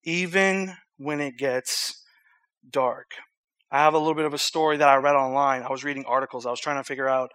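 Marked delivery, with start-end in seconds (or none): 0.0-3.1 slow and softer, pausing before the last word; slow solemn repeat. Real, not acted. Preacher repeating tonight's message title, slowly and solemnly.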